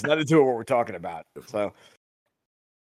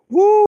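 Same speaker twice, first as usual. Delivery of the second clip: high loud whoop at a fiery bite; first bite of five-alarm Thai food, loud whoop; mock yelp from heat; 13 dB louder than usual. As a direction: whoop as the spice hits.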